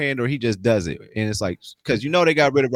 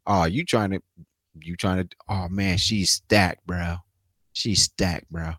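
lower, slow, lecherous drawl; leering bro; 8 semitones lower, slower than usual. Retold or imitated. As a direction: savour "stacked" like a creep. Imitating a leering owner.

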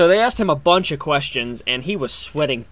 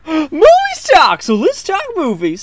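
silly clown; 8 dB louder, slower than usual. Acted. Goofy intro voice.

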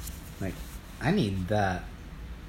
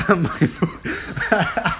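cracking on vo-; 6 semitones higher, 4 dB louder than usual. Real, not acted. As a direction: voice cracks mid-word with a laugh. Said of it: "my voice just cracked".

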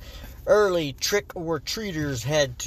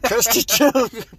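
higher, louder, cracking up; laughing; 7 semitones higher, 5 dB louder, slower than usual. Real, not acted. Host cracks up at his invented wrestler name, laughing.

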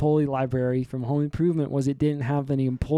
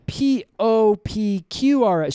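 letters sung out slowly and higher; slow alphabet recital; 7 semitones higher, 4 dB louder, slower than usual. Acted. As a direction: recite it like a kid working it out.

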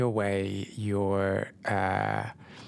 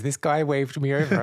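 a teasing echo of her friend's story line, pitched up a little; singsong teasing imitation of a friend's catchphrase; teasing mock quote; 5 semitones higher, 4 dB louder than usual. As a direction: poke fun at how the friend always tells it. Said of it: "Every story I'm getting from you is".

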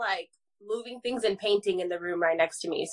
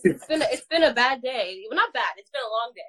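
usual speaking voice

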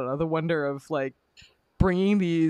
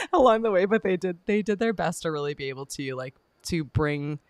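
normal talking voice

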